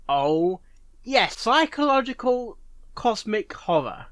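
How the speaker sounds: ordinary talk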